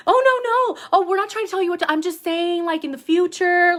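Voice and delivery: high, mocking voice of hasty retreat; backpedaling bosses. Bosses taking back their criticism to the employee, high mock backpedaling. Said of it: "they backpedaled so quick".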